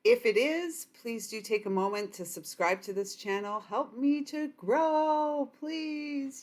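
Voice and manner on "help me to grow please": high, slow sing-song begging for subscribers; playful plea. Real, not acted. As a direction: coax them sweetly, stretching each word. Asking viewers to subscribe.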